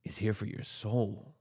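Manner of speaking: slow, hushed, ominous promo; menacing Rock voice. Retold or imitated. Retelling The Rock's promo to a friend, slow ominous voice. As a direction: hiss the threat slowly. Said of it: "and he goes".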